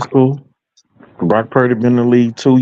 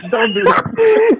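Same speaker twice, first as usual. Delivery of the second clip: laughing; 9 semitones higher, much slower than usual. Real, not acted. Laughing at the trolling caller.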